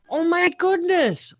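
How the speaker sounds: high, touched oh my goodness; moved gasp. Real, not acted. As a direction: melt at the cute moment. Touched by a tender moment.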